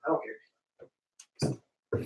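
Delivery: hushed throwaway mutter; whispered aside. Real, not acted. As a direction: toss it off under breath. Hurried aside.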